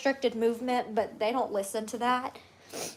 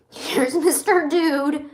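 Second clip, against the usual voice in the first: slow, louder proud announcement; excited; 4 semitones higher, 8 dB louder, much slower than usual. Real, not acted. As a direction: show off the pet proudly. Presenting pet to viewers.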